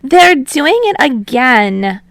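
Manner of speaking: loud high shriek, slow. Acted. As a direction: shriek it out excitedly. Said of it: "Mina shrieks".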